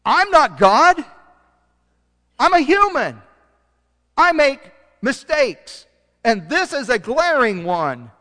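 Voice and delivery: high, loud cry of a remorseful king; anguished king. Acted. Preacher voicing the king, crying out his regret. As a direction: wail it out as the king who erred.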